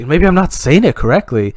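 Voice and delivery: ordinary delivery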